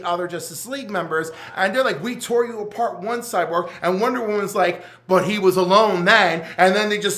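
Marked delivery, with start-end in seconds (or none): 2.0-6.5 excited. Retold or imitated. Heroes and villains quoted in battle.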